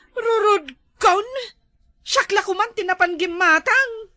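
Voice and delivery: loud, high exasperated outburst; irritated and loud; noisy complaining. Acted. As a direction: complain loudly, voice pushed up. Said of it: "Agriri ka pay laang".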